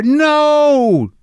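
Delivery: high, drawn-out "no"; exasperated high cry of no; emphatic shouted no. Acted. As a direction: wail it out as a blunt denial. Acted answer to anxious question.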